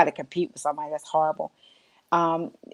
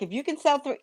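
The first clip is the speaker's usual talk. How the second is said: Melissa bargaining; 8 semitones higher, faster than usual. Retold or imitated. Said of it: "Melissa would be like".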